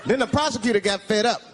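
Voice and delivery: ordinary delivery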